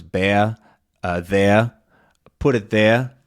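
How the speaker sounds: "bear" and "there" ending in uh, no R. Shown as a demonstration. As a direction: drop the R in "put it there". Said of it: "same thing with air becomes".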